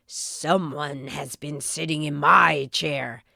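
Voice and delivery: low, gruff papa bear voice; growling Father Bear. A narrator's reading. Father Bear growls about his chair. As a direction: growl it out deep like a big bear. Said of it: "growled Father Bear".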